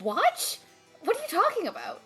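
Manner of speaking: ordinary delivery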